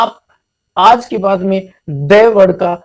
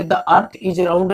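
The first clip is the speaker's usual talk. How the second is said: very slow, each word apart; धीरे-धीरे उदाहरण पढ़ना; slow example reading; much slower than usual. Shown as a demonstration. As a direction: spell the example out slowly. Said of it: "second example है".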